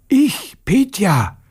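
a slow, lightly voiced high schoolboy answer; hoarse boy. Petja answers Aunt Natascha from the cloakroom, slow high boy voice. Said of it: "was hast du bloß heute für eine heisere Stimme".

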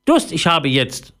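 high, demanding parrot. A narrator's reading. Thirsty parrot tells Philipp, high demanding voice. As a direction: state a demand in a bird voice. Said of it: "machte der Papagei".

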